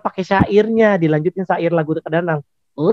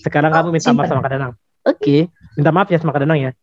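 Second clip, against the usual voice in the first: ordinary talk, nothing marked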